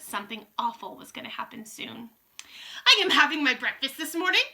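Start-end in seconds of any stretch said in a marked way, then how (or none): 2.9-4.4 high, loud witch voice; Grand High Witch crying out. A narrator's reading. Witch complaining to her coven, crying out. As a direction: call out like an irritated witch queen. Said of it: "cried the Grand High Witch".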